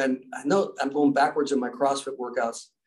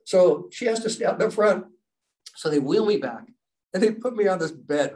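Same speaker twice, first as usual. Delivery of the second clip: plain reading voice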